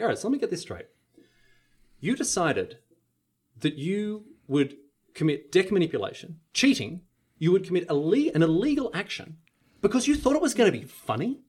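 stern judge. Retold or imitated. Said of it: "she was so serious and she was so full on".

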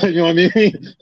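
high, laughing question. Real, not acted. Nate laughing at his own hospital story. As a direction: laugh the words out.

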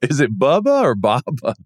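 "Bubba" with uh, "Baba" with a long ah; pronunciation contrast. Shown as a demonstration. Teasing co-host over the player's name, pronunciation contrast. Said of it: "Bubba sounds a little different".

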